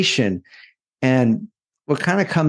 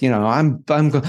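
ordinary talk, nothing marked

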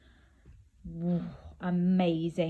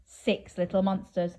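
usual speaking voice

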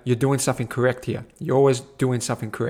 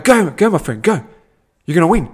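louder, higher cheering call; 4 semitones higher, 5 dB louder than usual. Retold or imitated. Cheering on the cyclist on the pathway, loud and high. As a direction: urge him on like a coach. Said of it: "I'm going".

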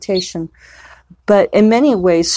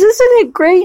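much higher, louder gush of delight; delighted friend; 4 dB louder than usual. Retold or imitated. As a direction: imitate a friend's gushing delight. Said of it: "And she says".

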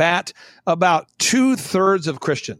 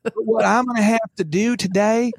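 a lightly voiced, overly polite churchy brother, a bit higher; mock Christianese; 3 semitones higher than usual. Guest mocking a pastor who spoke Christianese to him, lightly voiced. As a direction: imitate a pastor softening bad news in church-speak. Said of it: "'cause he's speaking Christianese".

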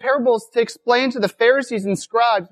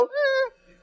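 high, softer noise of indifference; mock unimpressed "eh"; 6 dB quieter than usual. Preacher imitating Pharisees still unmoved by another story, high soft "eh". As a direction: give a small, high-pitched dismissive "eh". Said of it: "and they're still".

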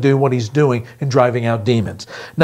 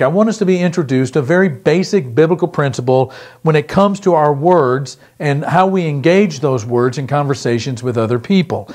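ordinary talk

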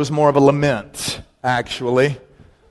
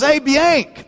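buddy's greeting; 10 semitones higher than usual. Retold or imitated. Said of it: "He says".

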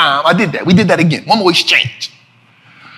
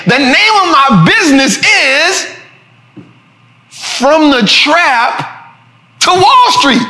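slow, high, with a pause before "From the Trap"; emphatic for effect; 5 semitones higher, slower than usual. Shown as a demonstration. Storyteller lets his business name sink in, slow and emphatic. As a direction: stretch "From the Trap" and lift "to Wall Street". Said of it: "let that sink in".

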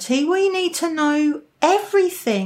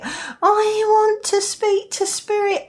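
high, drawn-out mock pleading; hammed-up eager insistence; insistent beginner; 8 semitones higher than usual. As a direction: play an overeager beginner, voice pitched high. Repeats the beginner's wish, more insistently.

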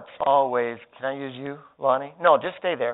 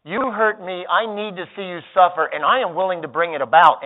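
louder, higher vengeful threat; hammed-up vengeful threat; acting out active anger; 4 semitones higher, 6 dB louder than usual. Shown as a demonstration. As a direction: threaten to get even. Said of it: "active anger actually is this".

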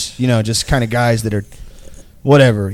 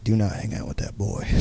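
serious dad; 4 semitones lower, 14 dB quieter than usual. Retold or imitated. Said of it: "he got very serious".

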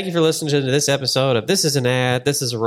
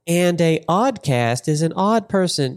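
Siri impression; 7 semitones higher than usual. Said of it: "Siri would be like".

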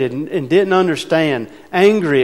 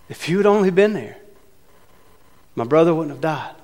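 frustrated Martha; faster than usual. Shown as a demonstration. Martha reproaching Jesus over her brother, frustrated. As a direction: reread it with hurt frustration. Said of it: "Frustrated, uncertain about what's going on".

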